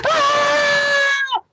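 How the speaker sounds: very high, sustained shriek; hysterical scream. Acted. Car hop turns and looks at Alvin, high hysterical scream. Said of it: "looks at the car and goes".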